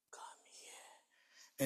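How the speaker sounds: faint whispered call; a coma patient whispering to his brother; weak whisper. Retold or imitated. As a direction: barely breathe the words. Sick brother calling weakly.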